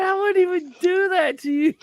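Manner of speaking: high, laughing through the words; amused laughing protest. Real, not acted. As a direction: get it out between laughs. Laughing while retelling his reply.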